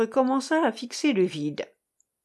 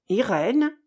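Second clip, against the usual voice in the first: Irène called as a question; 3 semitones higher, 4 dB louder than usual. A narrator's reading. Lady in yellow hat calls to Irène, questioningly. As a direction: lift the name into an inquiry. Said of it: "d'un ton interrogatif".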